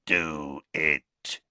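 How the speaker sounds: low, rasping command; rasping bark. A narrator's reading. Greyback urging Draco to do it, rasping. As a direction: rasp it out low like a beast. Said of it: "a rasping bark of a voice".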